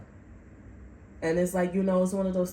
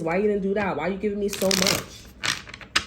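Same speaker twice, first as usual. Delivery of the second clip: normal talking voice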